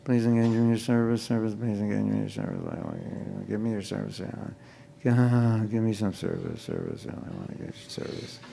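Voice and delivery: fast, quiet, mumbled prayer that trails away; mocking a careless devotee's chant; mock mindless prayer. Shown as a demonstration. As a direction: rattle it off like you don't mean it. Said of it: "this is what you're communicating to Krishna".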